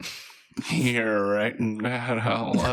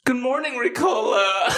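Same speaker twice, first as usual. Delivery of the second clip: cheery dad voice; 13 semitones higher than usual. Acted. Father greets daughter, high.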